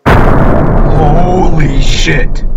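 drawn-out, loud cry of shock; shocked shout. Acted. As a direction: yell it, stretched way out. Shock at something horrific.

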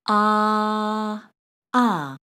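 "Ah" held long and level, then short and falling; open-mouthed AH. Shown as a demonstration. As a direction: open wide for the dentist on "Ah". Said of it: "dentist asks you to open your mouth".

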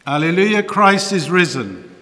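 loud joyful Easter acclamation; joyful proclamation. Real, not acted. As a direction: proclaim it to the congregation.

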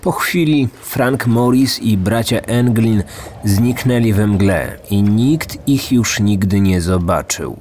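plain reading voice

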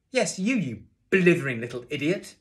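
loud, high, furious insult; angry shouting. A narrator's reading. Teacher berating a pupil before class, angry shouting. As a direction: lay into him at full volume. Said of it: "He was shouting at me".

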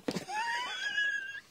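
sudden high yelp of pain with a laugh; real startled cry after a cut. Real, not acted. A crafter who just cut her finger, crying out. Cuts herself with the knife.

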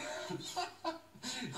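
giggling. Real, not acted. Laughing fit on air.

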